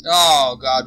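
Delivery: sudden loud gasp of alarm; blurted out in fright; startled. Real, not acted. Startled by game scare.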